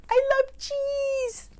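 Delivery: very high, drawn-out wail; craving wolf. Acted. Wolf overcome by love of cheese, high slow wail. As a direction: squeal it slowly, overcome with craving.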